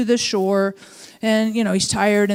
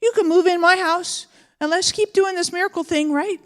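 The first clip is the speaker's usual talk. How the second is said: much higher, eager hello; mock thrilled invitation to move in; excited welcome; 6 semitones higher than usual. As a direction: greet him like a jackpot just walked in. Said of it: "I'd be like".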